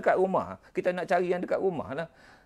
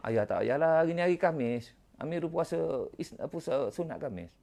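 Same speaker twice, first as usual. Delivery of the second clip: put-on soft child's reply; 7 dB quieter than usual. Amirul tells his father he is fasting, said softly. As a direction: answer gently like a shy boy.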